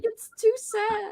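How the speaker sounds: slow high whine; drawn-out high whimper; high whiny voice. Retold or imitated. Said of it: "I was like".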